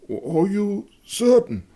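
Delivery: high, slow, frightened question; pale with fear. Acted. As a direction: ask it high and slow, shaken. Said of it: "The Schultz went pale".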